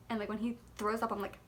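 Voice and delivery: ordinary talk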